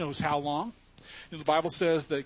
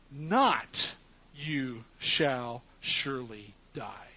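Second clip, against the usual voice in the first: a slow serpent's lie with Not up front; stress on Not; slower than usual. Shown as a demonstration. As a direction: lean hard on Not and draw the rest out. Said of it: "he puts the word "not" in front".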